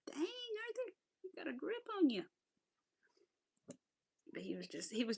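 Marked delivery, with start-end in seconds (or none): 0.1-2.2 pitched way up, teasing her dog; high mock-scolding voice. To her dog Arthur, a high teasing scold. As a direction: squeak it out like a startled owner. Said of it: "I was like".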